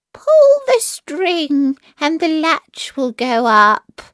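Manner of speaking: put-on high, slow old-lady call; grandmother crying out. A narrator's reading. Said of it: "cried out".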